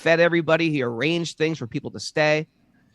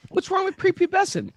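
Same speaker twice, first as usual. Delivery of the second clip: indignant surprise. Real, not acted. Defends his word choice.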